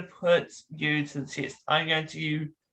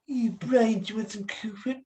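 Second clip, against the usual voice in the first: higher cartoon baby voice with a stretched Cool Whip; Stewie Griffin voice; 6 semitones higher than usual. Doing Stewie for the guest to guess. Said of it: "it's Stewie Griffin".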